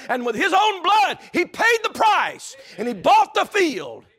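high, loud shouted preaching; preaching at full force. Acted. Preacher shouting to the congregation at full force. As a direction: lift the room with a ringing cry. Preaching climax on blood price.

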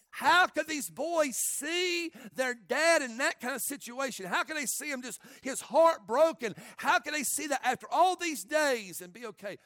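high, loud, impassioned preacher cry; outraged preaching. Real, not acted. Preacher asking how sons could watch dad's heartbreak, outraged preaching. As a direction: hammer each question at full force.

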